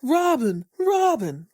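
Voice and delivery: high, falling name call at normal volume; mock sleep shout. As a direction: imitate someone calling out a name in his sleep. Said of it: "has a nerve to shout out".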